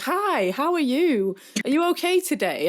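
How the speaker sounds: high, sing-song over-friendly greeting; obnoxiously friendly. Acted. Greeting women who grimace at them in the toilets, obnoxiously friendly. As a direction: lay on mock cheeriness, pitched right up. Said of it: "just be obnoxious as fuck and be like".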